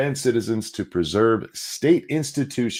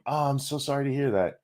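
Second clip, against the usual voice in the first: usual speaking voice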